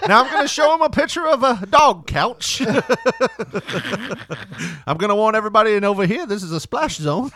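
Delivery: high, loud comedian showman; Gallagher impression. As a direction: announce it like a prop comic. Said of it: "Like they're at a Gallagher concert".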